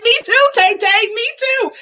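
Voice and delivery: high, hammed-up weepy cry; mock tearful agreement. Fan sharing [name]'s tears, mock weepy. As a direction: wail along in exaggerated sympathy. Joining [name]'s tears, exaggerated.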